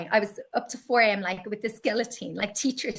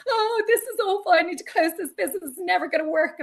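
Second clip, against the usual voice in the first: high, fast, overwrought wail; dramatic despair; 10 semitones higher, 5 dB louder, faster than usual. Retold or imitated. Mocking her own past panic, high dramatic wail. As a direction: ham up the melodramatic despair. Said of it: "It was so ridiculously dramatic".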